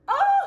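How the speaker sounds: short loud high squeal; excited squeal. Real, not acted. Reader overjoyed by the special card, squealing. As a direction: let out a thrilled "ah!".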